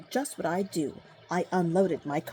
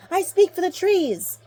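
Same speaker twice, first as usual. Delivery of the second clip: high, loud indignant cry; insist, at full voice; cried out; 11 semitones higher, 8 dB louder than usual. Acted. Lorax crying out again at the Onceler, high and loud. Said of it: "cried the Lorax".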